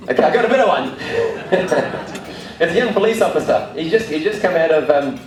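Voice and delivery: amused, laughter spilling into speech; laughing. Real, not acted. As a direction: talk while still laughing at the last joke. Laughing at his own joke.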